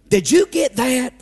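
ordinary delivery

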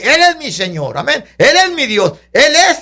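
ordinary delivery

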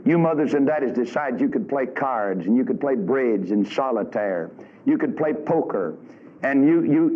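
usual speaking voice

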